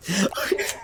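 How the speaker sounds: a burst of high giggling; high giggle. Real, not acted. Laughing at the chapter's surprise twist.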